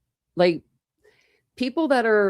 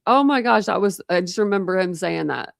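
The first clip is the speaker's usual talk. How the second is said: usual speaking voice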